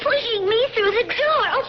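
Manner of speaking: high, crying pleas for help; frightened pleading. Acted. Lydia being pushed into the tomb, frightened pleading. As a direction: struggle and call out in fear. Girl pushed through door.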